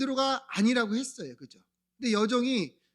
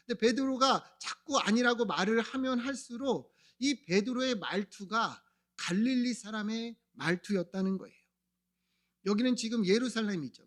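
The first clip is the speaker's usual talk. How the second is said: usual speaking voice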